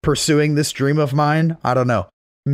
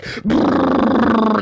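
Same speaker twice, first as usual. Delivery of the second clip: lawnmower engine imitation; 7 semitones higher than usual. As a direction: buzz like an old lawnmower motor. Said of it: "lawnmower engine".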